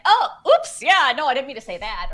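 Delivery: sudden high startled oops; oops surprise. Retold or imitated. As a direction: catch a slip with a quick yelp. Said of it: "I'm just like".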